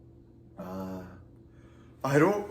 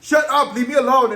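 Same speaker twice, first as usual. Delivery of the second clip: loud, higher, fed-up snap; mock angry snap; 8 semitones higher, 7 dB louder than usual. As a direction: bark it like an embarrassed friend who fell. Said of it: "She's like".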